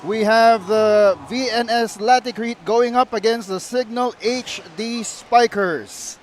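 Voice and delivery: upbeat, loud broadcast opening; sports broadcast intro. Real, not acted. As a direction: open the show like a TV host.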